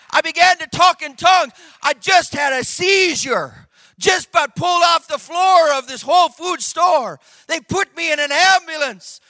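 loud, high, shouted testimony; full-force preaching. Real, not acted. Preacher testifying to congregation about tongues, shouted preaching. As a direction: preach it out at the top of your voice.

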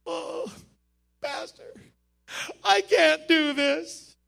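hammed-up crying plea, slow and unsteady; mock sob, breaking on "I can't do this"; imitating crying. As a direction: play a new believer sobbing to his pastor. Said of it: "they're crying".

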